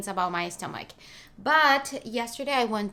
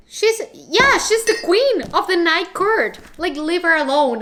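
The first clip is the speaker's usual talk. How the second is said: higher and louder, fired up about the queen; excited outburst; 7 semitones higher, 7 dB louder than usual. Real, not acted. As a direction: defend her with high excitement.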